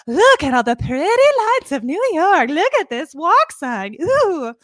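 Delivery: high, mock-wonderstruck gushing; hammed-up manic pixie, marvelling at everything; amazed dream girl. Mermaid seeing New York's lights, acted as amazed. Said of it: "she's just amazed by all the things".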